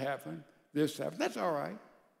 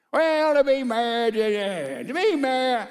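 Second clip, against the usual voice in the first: high, loud sneering voice mocking marriage; mocking catty remark; 4 semitones higher, 7 dB louder than usual. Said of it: "I hear that so much".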